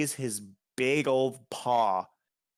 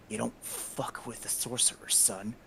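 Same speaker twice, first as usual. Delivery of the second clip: quiet menace; 7 dB quieter than usual. Acted. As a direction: lean in and menace softly. Sorcerer threatens the goliath.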